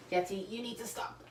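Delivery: soft aside to the cat; quiet scold to cat. Real, not acted. Quietly scolding her cat Yeti mid-video. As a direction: hush the cat under your breath.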